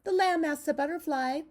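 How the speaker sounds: usual speaking voice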